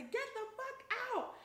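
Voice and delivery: high, loud furious order to get out; angry yell. Retold or imitated. Woman yelling at a strange man in her room, furious. Ordering intruder out, swinging at him.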